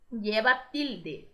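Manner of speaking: lleva tilde said slowly, stress on LLE; teacher modelling the Spanish term; slow model phrase. Shown as a demonstration. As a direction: drag out lleva tilde for learners. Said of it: "a gente fala".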